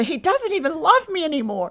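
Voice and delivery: hammed-up self-pity; mock despair. Wife's thoughts spiralling about her husband, mock despair.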